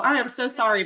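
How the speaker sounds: ordinary talk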